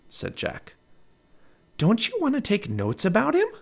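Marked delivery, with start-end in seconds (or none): 1.8-3.5 put-on little girl voice. A narrator's reading. Narrator voices Annie.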